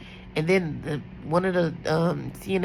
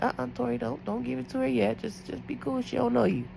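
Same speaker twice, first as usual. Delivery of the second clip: inner self-talk; 6 semitones higher, 5 dB quieter than usual. Retold or imitated. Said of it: "in my head, I said".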